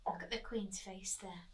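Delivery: ordinary delivery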